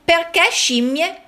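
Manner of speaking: usual speaking voice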